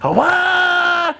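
high revving engine wail; drawn-out high motor noise; imitated chainsaw revving. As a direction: mimic a saw roaring to life. Imitates the saw cranking up.

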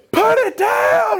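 high, slow strained holler; acted dad's frantic yell; yelled order. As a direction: shout it over a roaring engine, stretching the words. Father yelling over the saw.

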